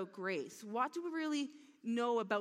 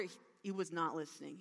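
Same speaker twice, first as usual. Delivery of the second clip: ordinary talk, nothing marked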